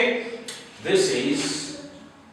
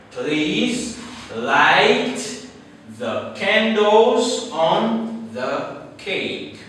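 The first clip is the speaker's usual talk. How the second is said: slow, careful sentence read; slow example read; 3 semitones higher, much slower than usual. Shown as a demonstration. Teacher reading an example sentence slowly to class. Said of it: "the first one is".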